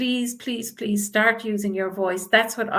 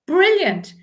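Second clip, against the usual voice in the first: excited praise; 9 semitones higher, 5 dB louder than usual. Real, not acted. Praising a viewer's line.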